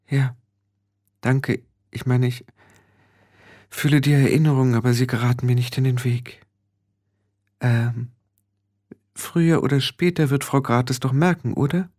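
zögernd, mit Pausen, etwas leiser; zaghafte junge Hexe. Acted. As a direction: verlegen nach Worten suchen. (unsichere Mädchenrolle)

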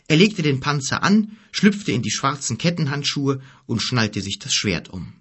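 plain reading voice

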